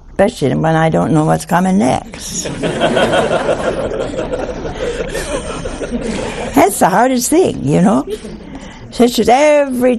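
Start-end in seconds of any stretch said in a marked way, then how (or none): 2.5-6.5 high unvoiced burst of laughter; laughing. Real, not acted. Mother Angelica laughing at herself about not knowing what comes next. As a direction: laughs at her own joke, a high breathy burst. Laughing at her own joke.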